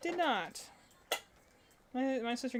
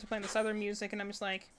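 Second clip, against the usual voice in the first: ordinary delivery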